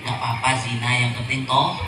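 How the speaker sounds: low, hushed coaxing; devil's whispering temptation. Acted. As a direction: tempt softly, as if into someone's ear. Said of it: "kamu saya bisikin".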